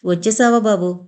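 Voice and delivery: usual speaking voice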